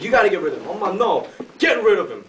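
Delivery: livid mother. Retold or imitated. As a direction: lay down the law as the angry mother. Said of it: "mom is just, just livid, just mad".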